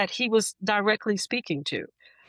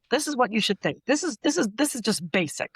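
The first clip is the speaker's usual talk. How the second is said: mock preachy lecturer; 6 semitones higher than usual.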